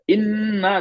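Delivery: इन्ना with nn held long and high; slow इन्ना, nn humming in the nose; nasal ghunna. Shown as a demonstration. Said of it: "गौर सुनिए".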